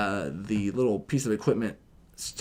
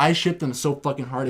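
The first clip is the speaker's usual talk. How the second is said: ordinary talk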